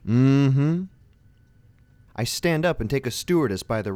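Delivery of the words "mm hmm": lightly voiced chorus of agreement; affirming mm-hmm. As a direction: hum a knowing yes. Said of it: "a very affirming".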